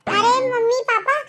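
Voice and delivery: high-pitched, startled greeting; surprised call. Acted. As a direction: call out on stumbling upon your parents. Son unexpectedly finds his parents outside.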